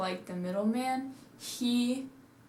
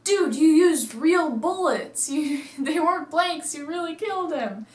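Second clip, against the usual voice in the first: higher, louder alarmed accusation; shocked outburst; 7 semitones higher, 6 dB louder than usual. Retold or imitated. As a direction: shout in disbelief at him. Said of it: "And then they're like".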